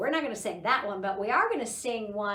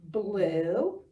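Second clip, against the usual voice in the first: slow, drawn-out naming, each word its own; teacher naming colors with toddlers, slow and clear; naming colors with children; much slower than usual. Shown as a demonstration. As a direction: say each color for the kids to repeat. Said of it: "help me say the colors".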